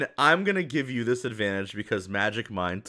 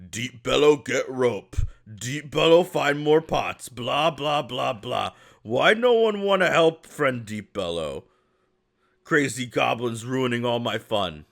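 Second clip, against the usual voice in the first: whiny ogre lament, swelling louder and higher; complaining simple ogre; 3 semitones higher, 4 dB louder than usual. Acted. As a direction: grumble like a put-upon, simple-minded ogre. Game master plays grumbling ogre.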